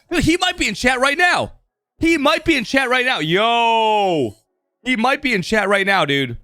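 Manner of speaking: excited. Real, not acted. Realizing the mover may be watching.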